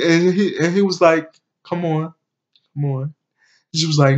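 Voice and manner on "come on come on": imitating a soft, coaxing man; quiet coaxing. Vlogger voicing a man at the opened cell door, softly coaxing. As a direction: coax gently, much softer.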